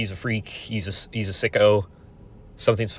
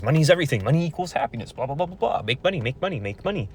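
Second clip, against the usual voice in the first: high, sing-song mock of grandpa's mantra; 6 semitones higher, faster than usual. Mocking grandpa's lecture on money, high sing-song. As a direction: parrot the old man's money talk, higher. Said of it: "that's what my grandpa says".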